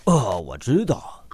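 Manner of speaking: ordinary talk, nothing marked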